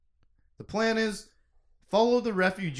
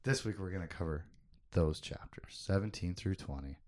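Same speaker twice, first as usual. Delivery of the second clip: muttered quickly; 9 semitones lower, 11 dB quieter than usual. Real, not acted. Rushed muttered recap of chapters.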